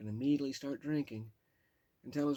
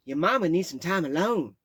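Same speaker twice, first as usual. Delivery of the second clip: loud, higher man's voice; the Cajun; 7 semitones higher, 14 dB louder than usual. Retold or imitated. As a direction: soothe her falsely as the Cajun. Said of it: "said the Cajun".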